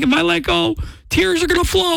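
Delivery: high voice holding back tears; high, pretend-tearful strain; mock quivering lip. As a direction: act on the verge of crying. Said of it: "little quiver where you're like".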